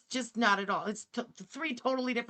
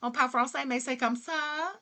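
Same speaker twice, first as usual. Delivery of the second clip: put-on Cajun French accent; 3 semitones higher than usual. Streamer imitating a Louisiana TikTok guy, Cajun French accent. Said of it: "That's totally Cajun".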